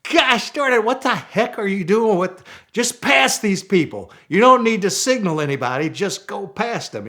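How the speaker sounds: high, loud frustrated yelling at the co-driver; exasperated outburst. Retold or imitated. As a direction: blow up at him, voice cracking high. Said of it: "And I finally said".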